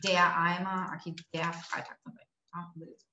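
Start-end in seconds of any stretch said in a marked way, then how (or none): 0.0-0.9 der Eimer with r as a; German demo word. Shown as a demonstration. Teacher modelling der Eimer for students. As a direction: say der Eimer, the der sounding like dea. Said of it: "Eu vou botar aqui o der".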